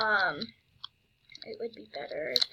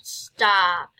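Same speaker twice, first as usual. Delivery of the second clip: ordinary talk, nothing marked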